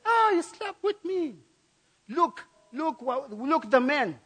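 high, mock outraged woman's cry; a preacher voicing the shrieking accuser; accusing woman. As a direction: play the lying wife crying foul. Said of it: "And then the woman screamed".

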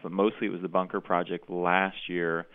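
usual speaking voice

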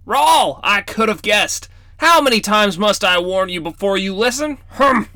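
weary grandfatherly warning; scolding grandfather. Acted. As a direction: wag a finger at the grandson.

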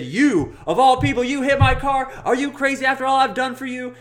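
hammed-up loud, high-pitched scolding; betrayed mock fury. Lady recognizes the man who hit her, mock outraged shout. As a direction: imitate a woman shouting in outraged disbelief.

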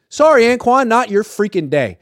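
loud high mock dismissal; coach's shout. Reporter voicing Harbaugh kicking Boldin out. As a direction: call it out as he sends him off. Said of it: "Harbaugh immediately threw Anquan Boldin out".

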